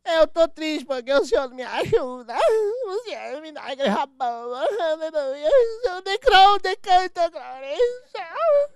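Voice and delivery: hammed-up crybaby begging the pastor; whining church member. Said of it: "sempre fala pro líder".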